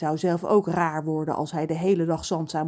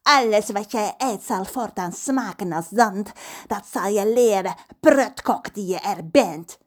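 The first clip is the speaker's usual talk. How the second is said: pirate curse; 3 semitones higher, 4 dB louder than usual. Acted. Pirate Pedro curses the cook over the railing. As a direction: pronounce the curse like a vengeful pirate. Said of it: "want Pedro zei toen".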